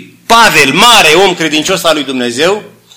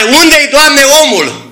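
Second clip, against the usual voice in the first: higher, urgent asking; Paul searching for the man, higher pitched; eager question; 8 semitones higher, slower than usual. Retold or imitated. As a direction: reach up toward God with the question. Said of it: "punea întrebare".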